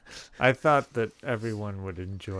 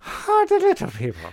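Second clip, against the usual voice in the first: put-on high, loud stage Irish accent; terrible Irish accent; 8 dB louder than usual. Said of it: "such a terrible imitation of a".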